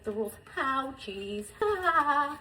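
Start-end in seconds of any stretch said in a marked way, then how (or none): 1.6-2.4 laughing ow. Real, not acted. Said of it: "Freaking burned".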